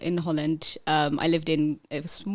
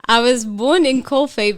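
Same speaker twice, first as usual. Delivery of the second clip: laughing; 5 semitones higher, 10 dB louder than usual. Real, not acted. Laughing while answering.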